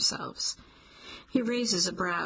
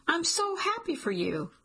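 high, bright burst of joy on so; gushing with sudden relief; overjoyed; 10 semitones higher than usual. Acted. Jolene tells Cliff she's thrilled he stays, high and overjoyed. Jolene reacts to his staying.

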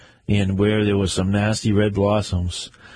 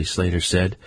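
ordinary delivery, nothing marked

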